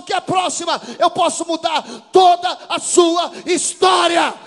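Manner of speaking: loud, climbing, shouted sermon; full-force preaching. Acted. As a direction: drive the congregation to obey God.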